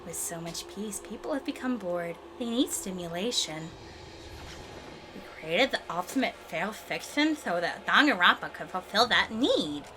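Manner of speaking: subdued game character. Acted. Reads game dialogue in character.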